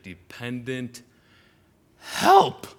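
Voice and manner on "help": high, loud desperate cry of help. Acted. Preacher voicing the dad's cry to Jesus, desperate high shout. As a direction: cry the word out like a last hope.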